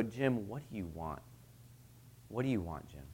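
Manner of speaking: gentle question. Retold or imitated. Jesus asks Jim softly what he wants. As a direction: ask it softly, like a caring friend. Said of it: "He would go".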